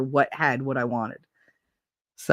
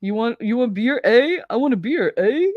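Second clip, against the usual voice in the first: playful mock Canadian accent, higher, every "eh?" pushed up; 5 semitones higher, 3 dB louder than usual. Streamer teasing chat in a mock Canadian accent. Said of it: "I kept fucking up my Canadian accent".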